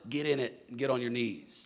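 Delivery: normal talking voice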